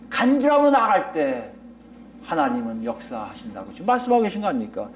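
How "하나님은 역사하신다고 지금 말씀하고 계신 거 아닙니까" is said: normal talking voice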